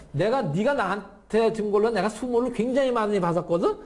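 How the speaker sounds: high, playful get-even taunt; comic taunt. Acted. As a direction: play it as comic payback, breaking into laughter. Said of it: "거의 코미디 같은 장면인데".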